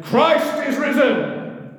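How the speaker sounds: slow, high, loud proclamation; shouted. Retold or imitated. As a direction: cry it out to the whole crowd. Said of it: "and he shouted".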